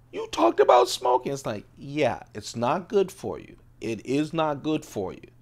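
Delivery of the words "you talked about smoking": put-on high, loud gasp then a shocked child's line; shocked grandkid. Imitating his grandkids, shocked that grandpa talked about smoking. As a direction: gasp like a scandalised little kid. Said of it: "My young grandkids".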